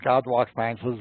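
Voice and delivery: ordinary delivery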